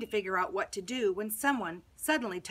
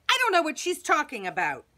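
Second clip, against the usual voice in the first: growled denial; 6 semitones higher, 8 dB louder than usual. Acted. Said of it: "I growled like a lion".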